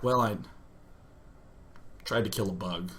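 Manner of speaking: low, soft, halting admission; pause, then mumble it reluctantly; sheepish confession. Retold or imitated. Patient confessing to the doctor, sheepish confession.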